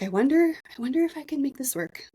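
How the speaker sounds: high-pitched, imitated self-doubt; mock wondering. Recalling doubts she never had, mock wondering. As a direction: lightly act out wondering, voice lifted high.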